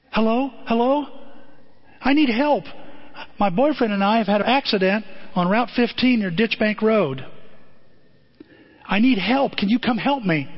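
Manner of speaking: high, pleading cry for help over the radio; frightened woman. Retold or imitated. Stranded woman begs over CB radio.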